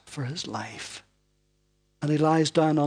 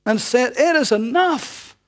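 loud, high, ringing preacher's voice; preaching at full force; 8 semitones higher, 8 dB louder than usual. Acted. Preacher booming the story to the congregation, full force. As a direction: proclaim it from the pulpit.

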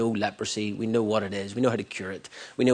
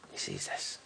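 whispered aside; 12 dB quieter than usual. Acted. Whispered aside about the heart.

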